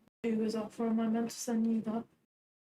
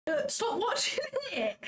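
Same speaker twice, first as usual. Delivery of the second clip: laughing protest; 11 semitones higher than usual. Real, not acted. Disgusted friend begs her to stop.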